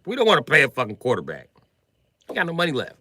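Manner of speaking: fan rant. Real, not acted. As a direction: vent like a fed-up fan. Fan ranting about team.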